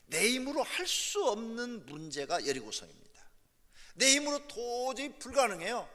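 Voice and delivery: high, loud cry building to a shout; full-force preaching. Real, not acted. Preacher tells congregation of problems beyond their strength, impassioned preaching. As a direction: press the hopelessness home with mounting force.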